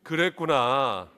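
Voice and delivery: slow, drawn-out 그랬구나 with falling tune; slow empathetic 그랬구나 to a neighbour. Shown as a demonstration. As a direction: stretch 그랬구나 gently to comfort the person beside you. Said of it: "옆에 있는 분에게 한번 그렇게 해보세요".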